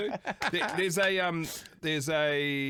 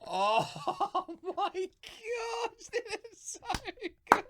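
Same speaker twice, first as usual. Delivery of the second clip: laughing; much slower than usual. Real, not acted. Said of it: "laugh cry shout".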